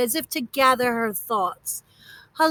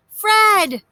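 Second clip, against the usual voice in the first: high, loud call into the distance; shouting; 8 semitones higher, 5 dB louder, much slower than usual. A narrator's reading. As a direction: call across the lake to the ducks. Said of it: "he shouted through cupped hands".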